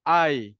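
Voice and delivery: loud calling I, like calling someone. Shown as a demonstration. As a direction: call out I as if hailing a person. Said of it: "kita nak panggil orang tu".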